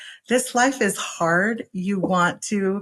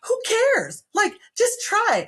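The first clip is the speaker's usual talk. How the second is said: carefree urging; 12 semitones higher than usual. Retold or imitated. Urging carefree try-anything mindset.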